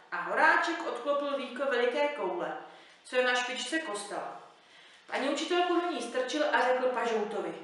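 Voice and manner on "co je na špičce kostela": ordinary delivery, nothing marked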